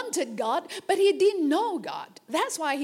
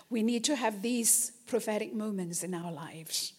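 ordinary delivery

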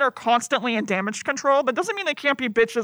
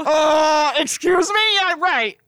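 mock offended; 4 semitones higher, 6 dB louder, slower than usual. Real, not acted.

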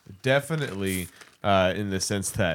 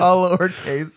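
mock announcer; 9 semitones higher, 5 dB louder than usual. Mock ad tagline.

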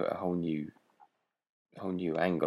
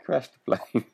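"Crash the plane" called out louder and higher, half laughing; louder joking call; 6 semitones higher, 5 dB louder than usual. Real, not acted. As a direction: shout the suggestion for a laugh. Joking suggestion, repeated louder.

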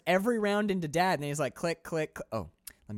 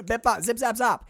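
silly bip bop noises; 9 semitones higher, 7 dB louder than usual. Pretend. Mock robotic busywork noises.

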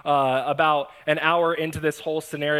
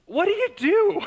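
incredulous. Real, not acted. Pastor shocked at van in house.

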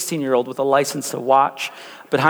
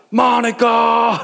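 drawn-out higher hammed-up movie yell; slow, high mock action-hero shout; mock movie cry; 6 semitones higher, 3 dB louder, much slower than usual. Racing like Fast and Furious.

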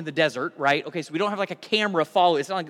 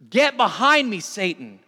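forceful rebuke; 3 semitones higher, 6 dB louder than usual. Acted. Jesus turns on Peter before the disciples, forceful rebuke. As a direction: fire it back as a stern command. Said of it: "he rebuked Peter".

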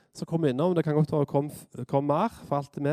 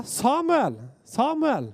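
high drawn call of a name; summoning call, falling at the end; 9 semitones higher, much slower than usual. Acted. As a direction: call him from afar, pitched well up. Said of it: "Da ropte Herren på Samuel".

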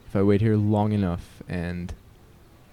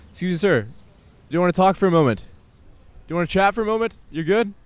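much higher and louder, hailing a man passing by; high, loud call; 7 semitones higher, 4 dB louder, much faster than usual. Real, not acted. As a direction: hail him high and loud. Calling out to a passerby.

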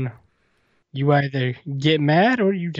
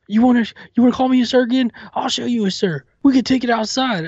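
loud, fast, higher confrontational voice; angry threat; 8 semitones higher, 3 dB louder than usual. Retold or imitated. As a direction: square up and threaten. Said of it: "And she goes".

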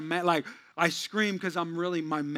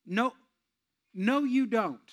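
ordinary delivery

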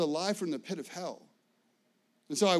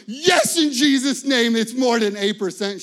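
much higher and louder, exultant; the preacher exulting at the tax preparer; triumphant shout; 6 semitones higher, 13 dB louder than usual. Retold or imitated. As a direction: shout it like a victory. Triumphant answer to tax question.

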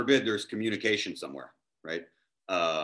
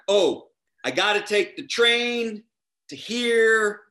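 working it out; 10 semitones higher, 6 dB louder, slower than usual. Retold or imitated. Acts out planning from a printed map.

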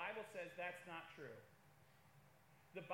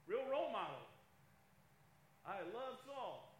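sarcastic. Real, not acted. Sarcastic praise of Saul.

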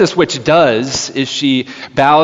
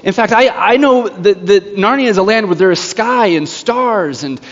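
put-on higher, insistent child's protest; mild protest; 7 semitones higher than usual. Child protesting to the witch, high-pitched mild insistence. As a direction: protest eagerly, imitating a child sure of it. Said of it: "tries to protest against this a little bit".

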